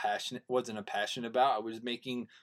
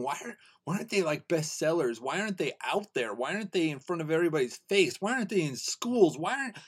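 higher, amazed string of questions; worked-up astonishment, rising in pitch toward the end; so excited; 3 semitones higher than usual. Retold or imitated. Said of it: "when I get so excited".

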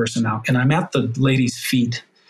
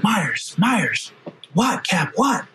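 high, choked calling out; 7 semitones higher, much slower than usual. Retold or imitated. Firefighter and captain in the truck, both crying, high and strained. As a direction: pitch up high, calling the name twice.

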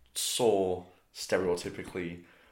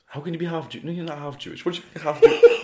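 higher-pitched disbelief, with laughter creeping in; mock-baffled, raised-pitch questioning; incredulous mum; 8 semitones higher, 5 dB louder than usual. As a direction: imitate a mum astonished by the claim.

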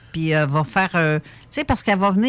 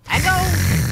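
sat-nav voice shouting, higher and louder; yelling GPS; 10 semitones higher, 4 dB louder than usual. Pretend. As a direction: GPS losing patience, yelling the turn. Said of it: "une voix de G P S".

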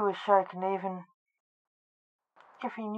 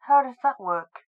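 higher, louder puzzled question; 5 semitones higher, 5 dB louder than usual. A narrator's reading. Senior asks Blake about the camera, puzzled. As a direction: ask it with mild bafflement.

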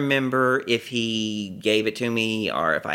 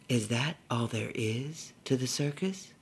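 put-on little girl question; 7 dB quieter than usual. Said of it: "her little girl, pragmatic answer".

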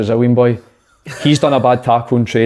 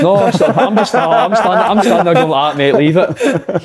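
laughing; 8 semitones higher, 3 dB louder, slower than usual. Real, not acted. Laughing while retelling the fight.

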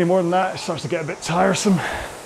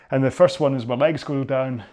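usual speaking voice

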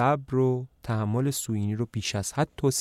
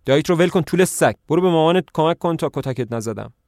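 shouting, higher and louder; angry shout; 5 semitones higher, 5 dB louder than usual. Acted. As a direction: yell at the girl to get lost.

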